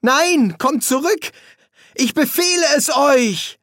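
very high, strained shout; desperate commanding shout. A narrator's reading. Boy commands his fleeing friends to return, desperate shout. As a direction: yell after them, ordering them back.

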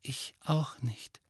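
slow, soft whisper. A narrator's reading. Ginny admitting she didn't know either, in a slow whisper. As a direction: whisper it, drawing the words out. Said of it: "flüsterte Ginny".

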